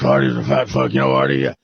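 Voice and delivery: very low conspiratorial schemer; Levy. Acted. Sleazy man explaining his plan to the girl, in a very low voice. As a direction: drop right down as the sleazy coach. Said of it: "And Levy goes".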